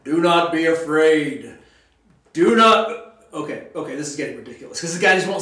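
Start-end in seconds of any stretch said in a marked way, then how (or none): 0.1-3.2 loud, slow, commanding angel call; booming angel voice. Retold or imitated. Teacher voices the angel to the screaming man: booming command. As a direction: proclaim it slowly over the screaming. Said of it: "you hear the angel go".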